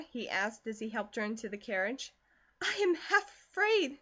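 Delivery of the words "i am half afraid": breathless, high and frightened; panting afraid. A narrator's reading. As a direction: gasp it out in fright. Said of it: "she panted".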